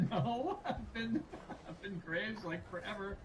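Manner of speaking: laughing. Real, not acted. Laughs at the stage name question.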